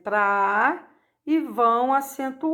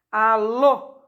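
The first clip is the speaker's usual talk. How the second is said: "Alô" with a strong final "lô"; tônica em LÔ; 4 dB louder than usual. Shown as a demonstration. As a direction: land firmly on the "lô" of "Alô". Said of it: "que é o lô". ("Alô" com a última sílaba forte)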